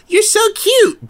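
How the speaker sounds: very high, sugary fake compliment; hammed-up high cooing; mock gushing. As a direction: gush it in a squeaky mock voice. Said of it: "most of these motherfuckers are like".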